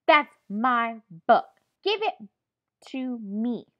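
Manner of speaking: loud, slow, emphatic demand; angry. Shown as a demonstration. Teacher demonstrating an angry demand for pupils, angry. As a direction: hit each word hard in anger. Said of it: "I sound mad".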